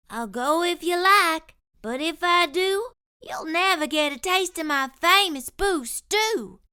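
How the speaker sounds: lightly voiced plucky cook; Curly Locks voice. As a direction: stand your ground, sweetly stubborn.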